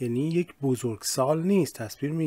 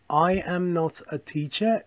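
slow full form; 3 semitones higher, much slower than usual. Shown as a demonstration. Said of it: "full form shown as example".

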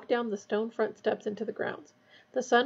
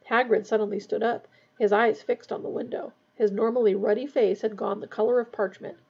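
ordinary delivery

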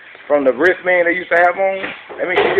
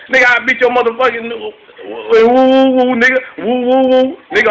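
hyped-up fake-tough yelling, higher and louder; mock angry outburst; 6 semitones higher, 5 dB louder than usual. Imitating a mad guy at the club, hammed-up loud woo yells. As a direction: mimic a guy losing his words in anger, yelling woo.

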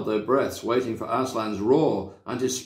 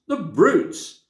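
high, louder tearful The brutes; tearful outrage repeated; 9 semitones higher, 4 dB louder than usual. A narrator's reading. Lucy crying again at the jeering crowd. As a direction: cry it again, more upset. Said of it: "tears streaming down her cheeks".

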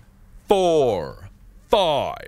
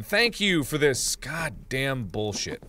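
normal talking voice

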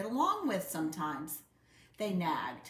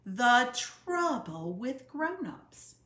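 slow, loud announcement of the title; shouted title; 4 semitones higher, 6 dB louder, slower than usual. A narrator's reading. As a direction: call out the title together with gusto. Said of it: "shouted all the others".

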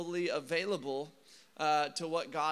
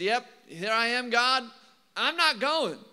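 a hint of a cocky Jonah, pushed a little higher and louder; mock stubborn refusal; 4 semitones higher, 7 dB louder than usual. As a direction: toss off the refusal, cheeky and sure of yourself. Jonah flatly refuses God.

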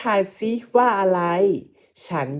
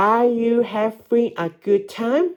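"Are you having a good time?" climbing high on "time"; rising question tune; 4 semitones higher, much slower than usual. Shown as a demonstration. Teacher shows pupils how questions sound, rising high on "time". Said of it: "เวลาออกเสียงคำถามให้ออกเสียงสูงลูก".

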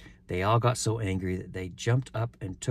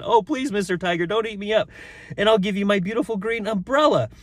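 put-on very high, pleading little-boy voice; pleading Sambo; 11 semitones higher, 6 dB louder than usual. A narrator's reading. Boy offering umbrella to escape being eaten, high plea. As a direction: beg in a high, pushed voice.

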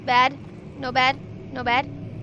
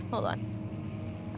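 whisper; 5 semitones lower, 9 dB quieter than usual. Real, not acted. Tense moment in horror game.

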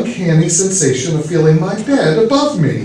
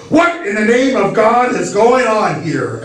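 higher-pitched exasperated demand; irritable, indignant question; 4 semitones higher than usual. A narrator's reading. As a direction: demand an answer, crossly. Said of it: "sleepy and irritable".

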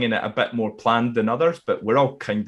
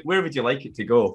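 ordinary talk, nothing marked

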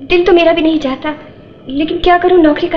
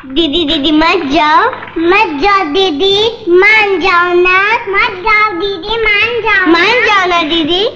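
pleading. Acted. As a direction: beg with long, dragged vowels. Children begging sister to stay.

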